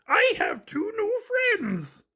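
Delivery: high, slow monster voice; high puppet voice, proud and slow; proud Grover. Acted. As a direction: tell mom the big news.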